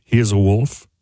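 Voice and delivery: mock forced low voice; Elizabeth Holmes. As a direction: lightly imitate her low, solemn delivery. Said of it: "talked in this weirdly forced low voice".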